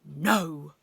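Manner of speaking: loud, firm refusal; one big emphatic word; booming. A narrator's reading. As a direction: boom it out like a stern teacher. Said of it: "he boomed".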